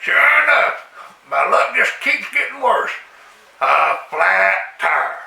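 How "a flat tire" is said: slow, drawn-out deadpan flat tire; a slow weary groan of bad news. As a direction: drag it out, deadpan. Deadpan repeat of bad luck.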